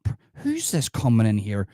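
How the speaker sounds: ordinary talk, nothing marked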